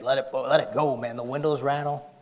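ordinary delivery

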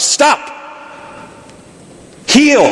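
sharp high commands; commanding a stray dog. Acted. Jesus rebuking wind and waves, sharp high commands. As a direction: bark them out like to a stray dog. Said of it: "like you speak to a stray dog".

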